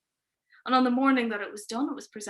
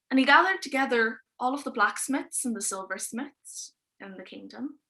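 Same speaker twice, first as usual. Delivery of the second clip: ordinary talk, nothing marked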